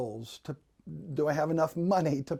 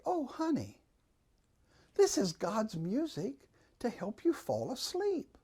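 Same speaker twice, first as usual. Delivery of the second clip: soft, high, soothing imitation of a mother; calm, sweet mother; 6 semitones higher, 6 dB quieter than usual. Mother reassuring her child about the rain, sweetly soothing. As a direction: gently hush a child to sleep. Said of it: "the calm voice of a mother".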